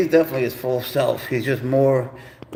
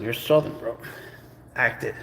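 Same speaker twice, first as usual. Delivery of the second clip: ordinary talk, nothing marked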